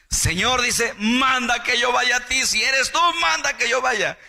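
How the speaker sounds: high, loud pleading Peter; Peter calling out. Retold or imitated. Peter pleading to Jesus, voiced.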